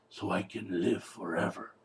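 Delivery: low, soft mock-doctor delivery; understated, deep-pitched imitation of the doctor; doctor's reply. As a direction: drop down low and quiet for the doctor. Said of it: "And the doctor says".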